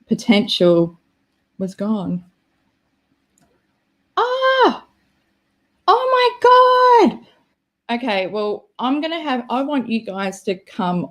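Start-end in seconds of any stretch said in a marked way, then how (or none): 4.2-7.3 high, drawn-out squeal; excited. Real, not acted. To a viewer, a slow high thrilled squeal. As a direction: stretch it out in a high delighted squeal.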